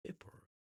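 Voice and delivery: usual speaking voice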